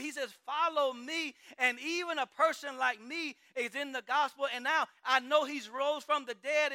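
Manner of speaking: loud, fervent preacher voicing the apostles; preaching at full force. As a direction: preach it, lifting the crowd with each testimony. Voices apostles in passionate preaching.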